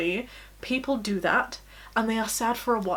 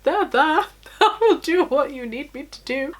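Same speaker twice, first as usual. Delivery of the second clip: higher, louder mock soothing; playfully exaggerated there-there voice; imitated kindly comforting; 4 semitones higher, 4 dB louder than usual. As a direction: act out a partner's earnest consoling. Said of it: "he's so kind and he's so wonderful".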